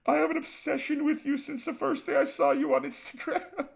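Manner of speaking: high, laughing mock voice of a desperate fan; mock lovesick fan. YouTuber mocking the fan's devotion in a high voice, laughing. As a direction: read it swooning while cracking up. Reading the fan's text aloud.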